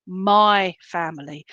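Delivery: "my" held long, then "family" falls away; stress on MY. Retold or imitated. Medium insists it's dad's phrase, stress on "my". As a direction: put the weight squarely on "my". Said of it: "can't emphasize the word my".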